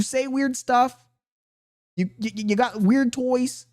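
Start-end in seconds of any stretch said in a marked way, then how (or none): none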